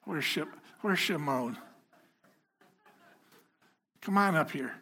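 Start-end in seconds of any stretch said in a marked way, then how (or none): none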